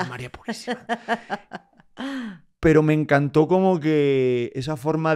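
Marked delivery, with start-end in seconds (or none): 0.0-0.8 scandalized grandmother. Retold or imitated. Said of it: "La abuela".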